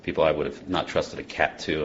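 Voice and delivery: ordinary talk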